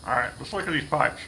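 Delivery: talking around a pipe stem; pipe in mouth. Real, not acted. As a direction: speak with a pipe clenched in your teeth. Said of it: "Trying to talk and smoke at the same time".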